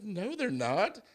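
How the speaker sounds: wife dismissing his panda claim, mock high; imitating his wife. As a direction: shut the lie down as his wife. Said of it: "she's like".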